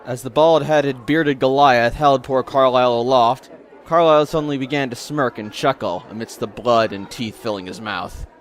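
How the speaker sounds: ordinary talk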